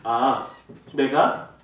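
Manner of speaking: ordinary delivery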